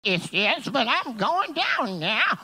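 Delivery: hammed-up voicing of her plan; imitating her. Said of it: "And she's like".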